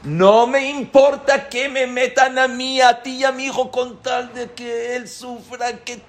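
high, loud, bitter vow; spiteful outburst. Retold or imitated. Obsessed man vowing he'll sink everyone so the governor suffers, spiteful and high. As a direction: spit it out high and hard, out of pure spite.

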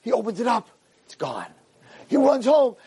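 ordinary talk, nothing marked

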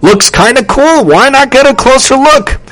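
high-pitched, eager wondering; curious boy's thought. A narrator's reading. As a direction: think aloud with keen curiosity.

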